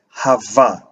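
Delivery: "Hava" with the v held as if doubled; emphasized Vav. Shown as a demonstration. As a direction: lean on the v in "Hava". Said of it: "emphasize Vav more".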